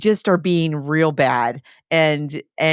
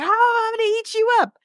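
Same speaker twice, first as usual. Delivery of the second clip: much higher, silly monster voice; hammed-up little monster threatening his mom; playful monster; faster than usual. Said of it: "He's like".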